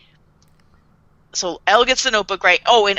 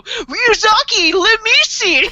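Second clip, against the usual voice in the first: high, loud, drawn-out mock whine; hammed-up whiny plea, laughing; Light Yagami; 13 semitones higher, 4 dB louder, slower than usual. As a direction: whine it like a demanding little baby. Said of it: "whiny little baby Light".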